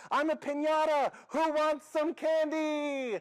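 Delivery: high, loud, drawn-out crazed shout; wild, full-voiced holler; hyper yell. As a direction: yell it at passersby like a hyper kid. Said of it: "yell at people walking by".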